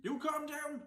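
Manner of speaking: sudden high yell right back; mock shouting back. Yelling back at the vlogger on screen, mock outrage. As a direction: throw her words back at her, hammed-up.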